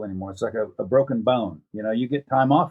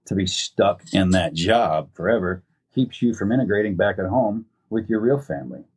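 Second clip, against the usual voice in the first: ordinary talk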